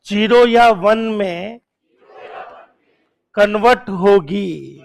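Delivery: "Zero या one में convert होगी" spelled out slowly, each word weighted; slow emphatic rule. Shown as a demonstration. Teacher drilling the class on the rule, slow and deliberate. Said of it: "teacher stresses the key rule".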